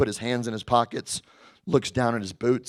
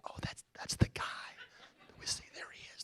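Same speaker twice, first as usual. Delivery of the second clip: mocking whisper among the guys; 18 dB quieter than usual. Agents snickering at the new guy, whispered. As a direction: whisper it behind his back.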